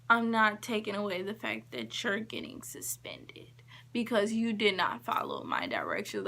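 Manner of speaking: whispered, cold refusal. Retold or imitated. Teacher denying the crying student, cold whisper. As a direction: hush it without remorse.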